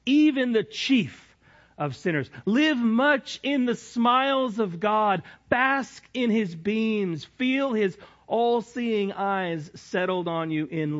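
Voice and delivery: high, slow impassioned reading; fervent preaching. Acted. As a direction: lift it up with fervor.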